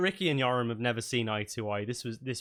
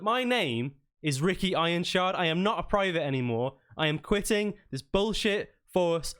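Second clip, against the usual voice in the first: defiant shouted resignation, pitch raised; Ricky; 4 semitones higher, 3 dB louder than usual. Acted. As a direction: quit angrily in your commander's face. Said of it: "in a shouting match with each other".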